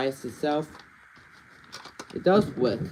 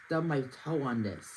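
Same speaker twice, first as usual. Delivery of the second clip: usual speaking voice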